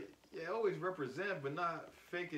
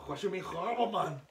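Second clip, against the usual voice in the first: bad Irish accent, raised and louder; 6 semitones higher, 5 dB louder than usual. Shown as a demonstration. Defending his accents to his friend in a botched Irish accent. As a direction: attempt an indignant Irish accent. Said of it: "That was my Irish accent".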